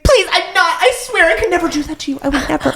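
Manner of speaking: very high squeaky begging; mock pleading. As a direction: beg in a ridiculous falsetto. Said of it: "pleading for this crazy man".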